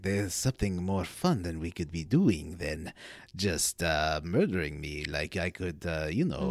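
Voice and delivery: low, suave seducer with a put-on Spanish accent; Antonio Banderas. Captured elf bargaining with his would-be killer, low seductive Spanish-accented voice. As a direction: attempt a smooth Spanish lover's voice, pitched well down. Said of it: "I can't really do the Antonio Banderas style voice".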